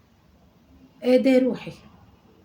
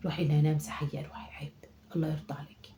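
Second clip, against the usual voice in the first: soft unvoiced coaxing; whispered pleading; 6 semitones lower, 6 dB quieter than usual. Grandmother quietly urging the girl to wipe off lipstick, whispered pleading. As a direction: hush and plead gently.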